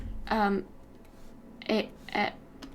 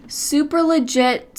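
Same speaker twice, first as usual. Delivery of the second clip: sarcastic; 4 semitones higher, 7 dB louder, slower than usual. Pretend. Sarcastic about the shortcut.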